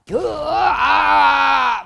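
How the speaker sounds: long high roaring scream; theatrical exorcism scream. Shown as a demonstration. Shaman mocking showy exorcisms, theatrical scream. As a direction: scream full out, hammed-up. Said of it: "막 이런 건 안 해요".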